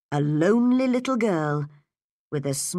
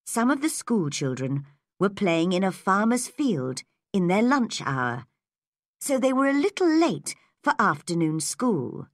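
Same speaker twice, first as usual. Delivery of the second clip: plain reading voice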